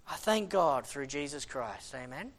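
ordinary talk, nothing marked

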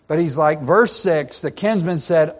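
ordinary talk, nothing marked

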